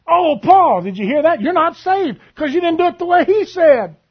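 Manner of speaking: high, loud mock astonishment at a friend; preacher mocks a rule-bound churchgoer with loud, high mock shock; mocking outrage. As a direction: ham up the shock to ridicule the claim.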